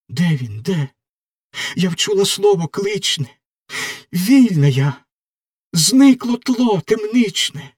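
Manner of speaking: lightly voiced girl rising into rapture; joyful young prisoner. Margarita hears her lover's voice, rising joy. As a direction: let joy break through as she recognises his voice.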